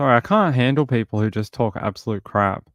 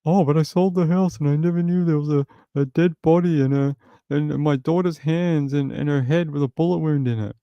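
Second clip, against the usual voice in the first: mock innocent heir; 6 semitones higher than usual. Mocking a clueless heir.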